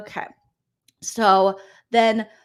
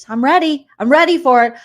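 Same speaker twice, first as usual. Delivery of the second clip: loud, higher burst; mock fired-up declaration; 4 semitones higher, 8 dB louder than usual. Recapper as suspicious housemate psyching herself up, loud mock bravado. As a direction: psych yourself up, punchy and loud.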